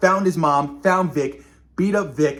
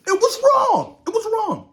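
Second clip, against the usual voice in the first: panicked realization; 3 dB louder than usual. Retold or imitated. Voicing Sophia's panicked realization.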